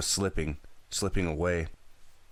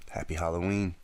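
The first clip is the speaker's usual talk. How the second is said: ordinary delivery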